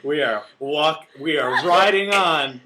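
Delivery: slow, loud announcer-style pun; mock dramatic. Host playing along with the pun, slow and grand. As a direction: lean into the joke slowly.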